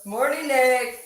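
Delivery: beaming wake-up call; cheery crewmate teasing a grump; cheery greeting. Retold or imitated. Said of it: "I started telling".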